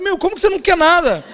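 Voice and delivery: high-pitched mock indignation; indignant. Dad retells reacting to daughter wanting nothing, indignant. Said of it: "Fiquei indignado".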